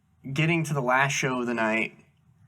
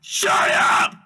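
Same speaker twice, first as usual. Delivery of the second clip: harsh all-out shout; furious yelling; 8 dB louder than usual. Pretend. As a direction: roar it at a noisy captive. Acted yelling at the captive.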